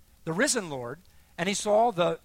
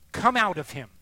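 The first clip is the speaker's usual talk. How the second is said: loud, forceful retold order; imitated sharp rebuke; exclaimed command; 7 dB louder than usual. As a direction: cast it out with a raised voice. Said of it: "Another exclamation point".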